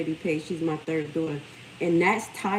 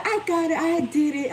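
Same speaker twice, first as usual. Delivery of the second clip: imitating proud daughter; 11 semitones higher, 4 dB louder than usual. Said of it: "She said".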